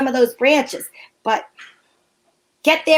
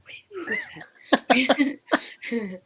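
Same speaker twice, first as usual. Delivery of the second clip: whisper; 7 dB quieter than usual. Acted. Whispered aside.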